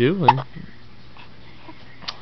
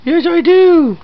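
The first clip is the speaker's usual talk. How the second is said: put-on high, louder squealing baby voice; 12 semitones higher, 6 dB louder than usual. Parent voicing baby, squealy.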